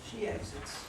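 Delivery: flat narrator reading the cue; stage direction. A narrator's reading. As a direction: read the stage direction plainly.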